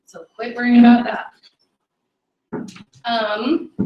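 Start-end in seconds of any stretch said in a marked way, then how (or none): none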